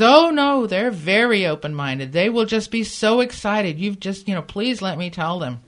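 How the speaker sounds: gushing, pleading persuasion, retold; eager reassurance. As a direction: imitate an excited caller, high and loud at the start. He eagerly overrules her doubts.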